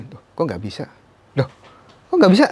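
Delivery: baffled high-pitched outcry, rising in disbelief; re-enacted alarm. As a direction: relive the shock of a body not responding. Re-enacting finding he cannot move.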